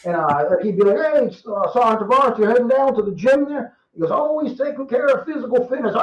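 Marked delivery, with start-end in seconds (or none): 1.0-3.8 lightly voiced major, pitched up; a hint of the old major asking him; imitating the major. Storyteller imitating his former major greeting him, lightly pitched up. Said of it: "I remember he talked like this". 4.1-5.9 lightly voiced major, pitched up; a hint of the old major's praise; imitating the major. Storyteller quoting his former major on fitness, lightly pitched up. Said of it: "that's just how he talked".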